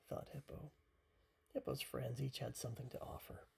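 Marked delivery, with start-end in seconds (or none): none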